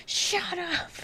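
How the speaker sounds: high, sharp mock yell; imitating a furious heel telling the crowd to shut up; angry shout. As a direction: snap it like an angry wrestler. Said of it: "with anger... and goes".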